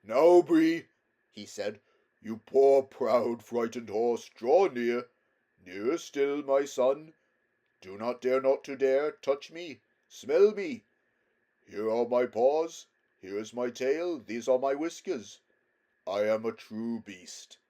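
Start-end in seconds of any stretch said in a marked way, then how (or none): 0.0-0.9 loud, high, commanding call; louder lion voice. A narrator's reading. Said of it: "spoke in a louder voice". 2.2-17.6 loud, commanding lion; louder lion voice. A narrator's reading. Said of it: "spoke in a louder voice".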